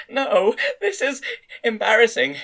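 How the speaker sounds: put-on high, slow, shy young woman; flustered and shy, half laughing; embarrassed. A narrator's reading. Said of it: "her face turned even redder".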